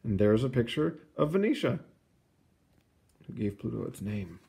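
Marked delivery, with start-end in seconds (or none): none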